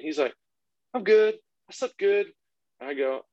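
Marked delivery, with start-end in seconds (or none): 0.9-2.3 put-on high-pitched chirpy little boy; super pumped kid. Son answering dad on waking up, pumped-up imitation. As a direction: imitate an eager child's morning answer. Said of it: "super pumped to start the day with me".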